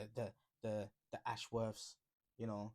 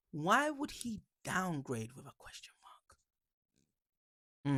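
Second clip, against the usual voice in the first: mock whisper; 3 semitones higher than usual. Reading comment mockingly.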